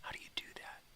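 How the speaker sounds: whispered puzzled dog voice; a confused pet, whispered; dog's voice. Pretend. The dog asking its owner, soft whispered voice. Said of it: "And he's like".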